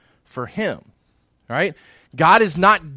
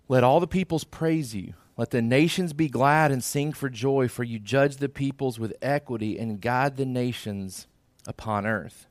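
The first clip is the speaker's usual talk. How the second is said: lower, unhurried Bible reading; scripture reading; 3 semitones lower, 4 dB quieter than usual. Acted. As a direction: read the verses with reverent weight. Reads psalm aloud before teaching.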